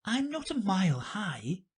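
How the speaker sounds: put-on high, small girl voice; high girlish denial; protesting Alice. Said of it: "said Alice".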